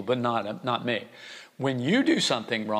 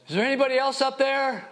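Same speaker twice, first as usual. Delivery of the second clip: high, loud plea toward the sky; desperate call upward; 13 semitones higher, 3 dB louder, faster than usual. A narrator's reading. Man on the branch rejects God's offer, high loud desperate call. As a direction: yell it up, hoping someone else answers. Punchline, man calls skyward.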